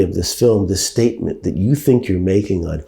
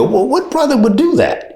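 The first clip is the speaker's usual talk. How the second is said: high-pitched mock disbelief; incredulous retort. Actor questioning his own character's choice, high-pitched incredulity.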